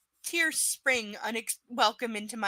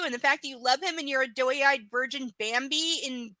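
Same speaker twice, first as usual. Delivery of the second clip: normal talking voice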